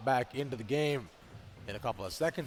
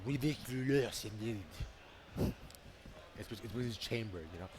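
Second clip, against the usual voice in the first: lower, softer mock French accent; 5 semitones lower, 13 dB quieter than usual. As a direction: drop into a lazy French accent. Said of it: "is that your French accent?".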